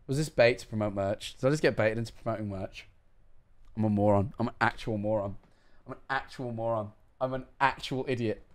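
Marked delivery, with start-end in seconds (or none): 3.8-8.4 low, deflated muttering to himself; quiet self-reproach. Real, not acted. Streamer realising he got baited, quietly mortified. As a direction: berate yourself softly, sinking in embarrassment.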